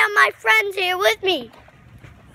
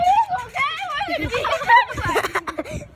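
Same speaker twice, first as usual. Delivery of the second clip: laughing; 7 semitones higher than usual. Real, not acted. Giggle after joke.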